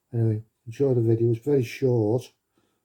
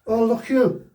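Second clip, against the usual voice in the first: high, sing-song Welsh lilt; Welsh accent; 11 semitones higher, 5 dB louder, slower than usual. Pretend. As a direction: put on a hammy Welsh accent. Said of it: "Do we do it in a Welsh accent".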